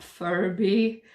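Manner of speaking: ordinary talk, nothing marked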